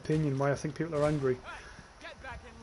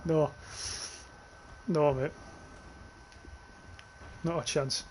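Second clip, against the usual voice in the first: normal talking voice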